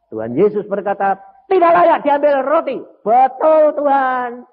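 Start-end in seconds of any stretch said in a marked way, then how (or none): 1.5-4.4 emphatic retelling. Acted. Retelling Jesus and woman.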